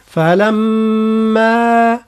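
"فلما" very slowly, a nasal hum on the م then a long final ا; ghunna and madd. Shown as a demonstration. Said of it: "هكذا". ("فلما" بالغنة والمد)

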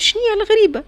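high, puzzled husband asking; puzzled question. A narrator's reading. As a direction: sound baffled at the strange thing. (سؤال مستغرب بصوت عالٍ)

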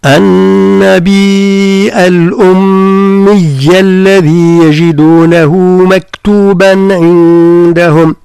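slow, drawn-out tajweed chant; Quran recitation. Shown as a demonstration. Teacher reciting a verse to his student. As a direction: chant the verse slowly, each letter given its due.

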